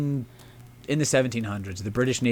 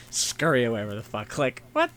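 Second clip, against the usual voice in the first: ordinary talk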